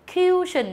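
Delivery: "cushion" said as "kyoo-shun", long yoo; mispronounced cushion. Shown as a demonstration. Teacher imitating some learners, "cushion" as "kyoo-shun". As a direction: say "cushion" wrongly with a y-glide and long u. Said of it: "hay đọc cái này thành cushion".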